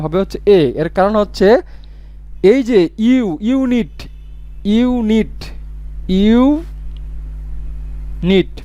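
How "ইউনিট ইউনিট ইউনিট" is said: ইউনিট slowed more each time, last one long and drawn out; sounding out unit. Shown as a demonstration. Teacher to class, showing the ইউ sound in ইউনিট, very slow. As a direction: stretch the ইউ of ইউনিট so students hear it. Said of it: "ইউ এর উচ্চারণ".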